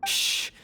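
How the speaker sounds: sharp shush for silence; hushing. Acted. Guard hushes the party in the dungeon, sharp shush. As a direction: hush them fast.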